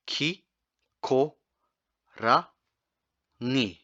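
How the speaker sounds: Kikorangi stretched out very slowly syllable by syllable; very slow demo. Shown as a demonstration. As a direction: draw out every sound of Kikorangi slowly. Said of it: "next one's a little bit tricky".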